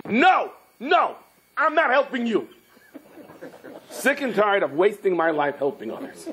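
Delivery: exasperated refusal. Acted. Man refuses the tree before storming away, exasperated high protest. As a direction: refuse high, thoroughly fed up. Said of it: "And he storms away".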